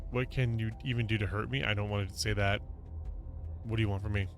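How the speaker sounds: ordinary delivery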